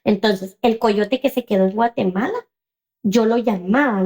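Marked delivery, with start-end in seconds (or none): none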